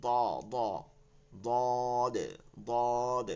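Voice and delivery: "দদ" with a rounded heavy vowel, held level; thick but soft. Shown as a demonstration. Teacher drilling the letter, thick and soft. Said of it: "plus নরম হবে".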